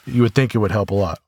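ordinary talk, nothing marked

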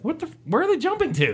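high, fast disbelieving outburst; surprised. Retold or imitated. Viewer reacting to the escape.

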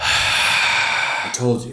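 drawn-out, voiceless exasperated aside. Retold or imitated. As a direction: sigh the words out, imitating exasperation. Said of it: "So exasperated".

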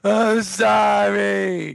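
drawn-out, loud wailing "I'm sorry"; crying plea. Retold or imitated. Siblings in tears begging stepfather, wailing plea. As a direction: wail it slowly like a sobbing child. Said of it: "were usually in tears".

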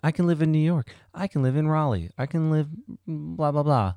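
high, mocking imitation of a friend listing cities; mock quote of friend. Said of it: "S1 quoting S0".

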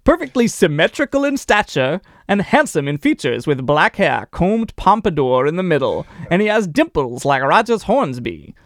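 hammed-up vintage sportswriter voice; swinging, put-on press-report delivery; old-time newspaper read. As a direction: read it like an old sports columnist singing a player's praises. Reads an old newspaper profile aloud.

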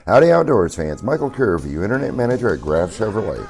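normal talking voice

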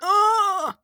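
high, drawn-out comic groan; pained groan. Real, not acted. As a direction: groan long and high at a bad pun. Groans at the goose pun.

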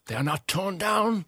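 high, overwrought Scrooge. Acted. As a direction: cry it out high and theatrical. Plays Scrooge in the reading.

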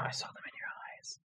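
soft mock-romantic whisper. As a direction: breathe it like a secret.

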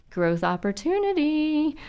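forced cheer through clenched teeth; through gritted teeth. Shown as a demonstration. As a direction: grit your teeth and say it slowly. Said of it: "say that through gritted teeth".

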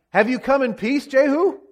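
high-pitched, rising query; wary question. Acted. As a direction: ask it high, lifting sharply on the name. Joram anxiously questions Jehu.

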